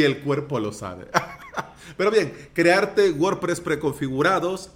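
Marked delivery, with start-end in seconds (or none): 1.1-1.6 high-pitched laugh. Real, not acted. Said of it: "así lo voy a dejar".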